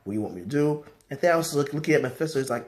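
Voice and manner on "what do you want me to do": soft, low fawning; mock brown-nosing. Mephisto sucking up to Thanos, mock brown-nosing. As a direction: flatter the boss in a hushed, submissive murmur. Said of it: "he's, like, brown-nosing Thanos".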